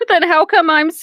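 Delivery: ordinary talk, nothing marked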